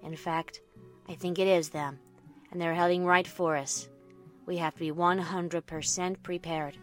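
low, soft, measured voice; quiet captain. Acted. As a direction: give a hushed, serious report. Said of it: "my quiet captain told me".

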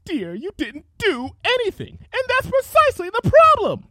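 high, loud bitter tirade; jealous rant. Acted. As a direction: rant with rising fury. Rarity ranting in jealousy.

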